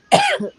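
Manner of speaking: harsh choking coughs; coughing fit. Real, not acted. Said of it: "I was choking".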